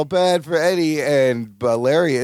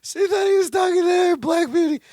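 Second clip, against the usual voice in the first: laughing disbelief; 11 semitones higher than usual. Real, not acted. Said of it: "that's a crazy tangent".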